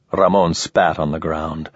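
ordinary delivery, nothing marked

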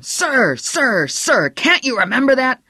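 high, furious screamed repetition. A narrator's reading. Stilson drilling respect into the kid, screamed. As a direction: scream each word at him, loud and high. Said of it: "Stilson screamed at him".

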